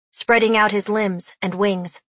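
usual speaking voice

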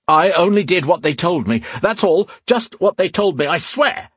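high, frantic self-defence; frightened, insistent excuses; panicked pleading. A narrator's reading. Terrified man pleads for his life.

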